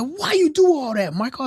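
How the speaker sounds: high-pitched, exasperated demand; mock scolding. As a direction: imitate a boss scolding an employee, pitched high. Boss scolds him after the firing.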